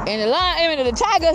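ordinary talk, nothing marked

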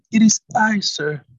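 put-on earnest young woman's reply; Ruth answering. A narrator's reading. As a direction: answer him earnestly in the dark. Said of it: "Ruth replied".

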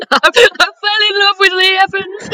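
high-pitched, helpless laughing speech; laughing in disbelief. Real, not acted. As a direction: squeal it out through laughter. Cracking up at co-host's confession.

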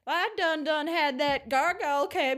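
slow mock Texas drawl; hammed-up, folksy Texas voice; Texas accent. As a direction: drag it out like a Texan. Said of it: "This woman from Texas".

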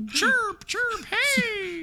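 very high squeaky bird voice, slow. Pretend. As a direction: squeak it like a bird pretending to be human. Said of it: "a bird, like, trying to walk into a cafe".

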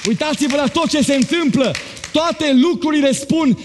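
high, rising preacher's fervour; fervent preaching. Acted. Pastor lifting the church toward Christ's coming, fervent preaching. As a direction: preach it up with mounting fire. Preacher building to full force.

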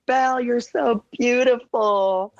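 high, gushing adoring mom. Retold or imitated. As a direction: gush over the princess. Mom quoted gushing at Belle.

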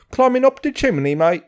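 mock Victorian English chimney-sweep accent. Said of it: "that fucking Victorian English shit".

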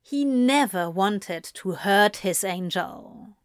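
ordinary delivery, nothing marked

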